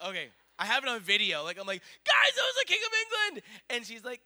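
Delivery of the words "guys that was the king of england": very high, fast, loud shriek; ecstatic yell. Retold or imitated. Parent filming tells kids about royal sighting, ecstatic shouting. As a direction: scream it in overwhelmed excitement. Replays own excited yell from video.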